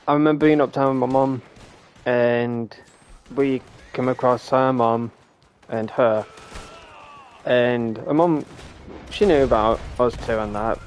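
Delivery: ordinary delivery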